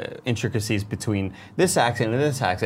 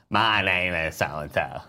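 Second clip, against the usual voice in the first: put-on slow, lower botched Australian accent; mock Australian accent; 5 semitones lower, 4 dB quieter, slower than usual. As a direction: imitate a friend's awful Australian accent. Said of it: "It's my Australian accent".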